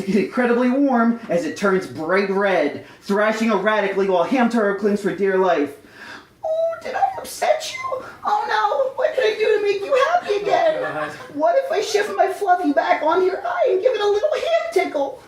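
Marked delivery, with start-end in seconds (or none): 6.4-15.2 very high hamster voice, laughing and half crying; squeaky mock-panicked hamster pleading with the worm. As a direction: fret aloud like a frightened cartoon hamster.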